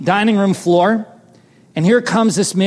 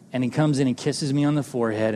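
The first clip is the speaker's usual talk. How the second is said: ordinary talk, nothing marked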